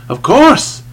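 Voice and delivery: high, loud, defiant exclamation; mocking bravado. A narrator's reading. Cheat confessing to the priest, mocking bravado. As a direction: toss it out with brazen bravado. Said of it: "with a sort of mocking bravado".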